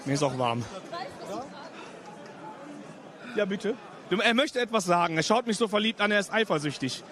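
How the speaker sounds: playfully low put-on voice; deep joking voice. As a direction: drop the voice low for the joke. Joking love scene, deep voice.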